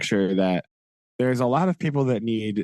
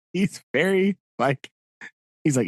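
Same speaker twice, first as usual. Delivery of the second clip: ordinary talk, nothing marked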